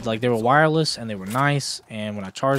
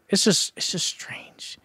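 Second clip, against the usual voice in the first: whisper; 7 semitones higher, 4 dB quieter, slower than usual. Real, not acted. Wistful musing on lost friends.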